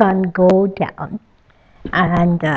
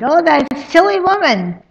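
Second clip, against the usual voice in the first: loud, higher, fed-up grumble that rushes at the end; annoyed grumbling; 10 semitones higher, 9 dB louder than usual. Acted. Husband grumbling to himself about his lost wife, loud and annoyed. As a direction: grumble about the trouble she's causing. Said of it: "he's annoyed".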